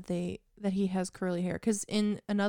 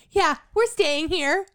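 mock dumb line; 6 semitones higher, 4 dB louder, slower than usual. Mocking a nonsense movie line.

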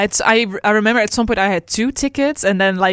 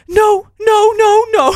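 high, loud retold panic; imitated screaming; 13 semitones higher, 6 dB louder, slower than usual. Imitating friend screaming during the ticket sale, frantic. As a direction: shriek it like the friend on the call. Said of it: "she just screamed".

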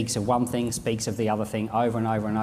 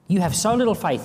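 raised, stern rebuke; Jesus scolding the disciples, a touch raised; 7 semitones higher, 4 dB louder, faster than usual. Acted. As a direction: scold them for weak faith. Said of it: "he says".

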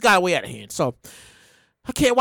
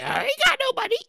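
high, softer comic plea; 10 semitones higher, 5 dB quieter than usual. Shown as a demonstration. Host joking about being broke, high plea.

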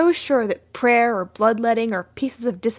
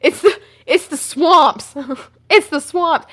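higher, louder mock certainty; mock conviction; 5 semitones higher, 6 dB louder, slower than usual. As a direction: insist on the swamps like a crank. Mocking the swamp theory.

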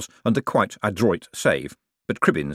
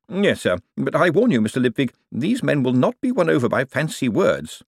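formal, cautioning voice, pitched up; stiff banker warning; 6 semitones higher than usual. Acted. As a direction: warn your superior firmly and properly. Bent's voice warning Moist.

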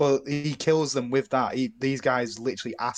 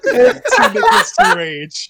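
laughing; 9 dB louder, slower than usual. Real, not acted. Laughing riff on the title.

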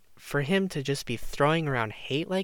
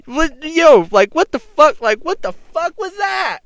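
high, loud outraged shout; startled yell at the insult; shocked yell; 9 dB louder than usual. Retold or imitated. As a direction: blurt it out in disbelief, much louder and higher. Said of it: "I was like".

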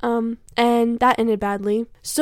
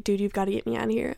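urgent quiet plea; 2 semitones lower, 7 dB quieter, faster than usual. Retold or imitated. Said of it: "I was like".

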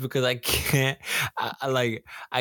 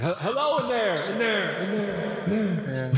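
calling out; 6 semitones higher than usual. Pretend. Calling into a space.

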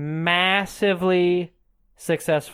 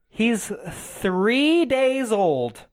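drawn-out, higher-pitched disbelief; indignant mother; 4 semitones higher, much slower than usual. Retold or imitated. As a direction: stretch it out, appalled on the baby's behalf. Mother's indignant reply to nurse.

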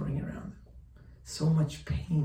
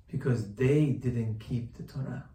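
usual speaking voice

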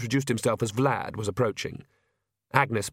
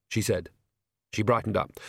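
ordinary delivery

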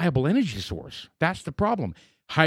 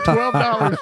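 laughing out the price, louder and higher; 8 semitones higher, 5 dB louder than usual. Real, not acted. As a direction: crack up while saying it.

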